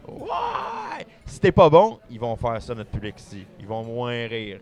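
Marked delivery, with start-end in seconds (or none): 0.1-1.1 crowd cheer. Retold or imitated. Magician imitates the Quebec audience with a high cheering whoop.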